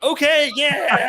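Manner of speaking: high, loud, drawn-out whoop; hyped-up cheer. Retold or imitated. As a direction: join the cheering with a laughing holler. Joins cheering, retold, laughing.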